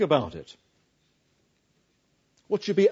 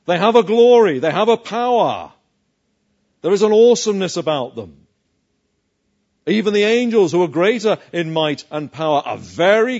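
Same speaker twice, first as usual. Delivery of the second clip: louder, driving each phrase home; emphatic preaching; 2 semitones higher, 8 dB louder than usual. Real, not acted. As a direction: pound the point about their power. Preacher stressing demonic power.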